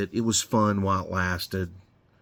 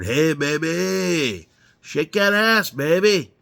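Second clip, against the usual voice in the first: higher, drawn-out hammed-up catcall; imitating a catcall; 6 semitones higher, 3 dB louder, slower than usual. Construction worker catcalling a woman, hammed-up higher holler. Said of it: "you're hollering at her".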